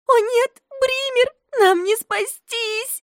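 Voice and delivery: high, loud panicked cry; terrified child; frightened girl. A narrator's reading. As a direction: wail that there's no escape. Girl panics facing the bear.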